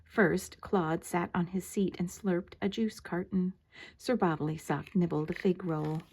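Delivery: usual speaking voice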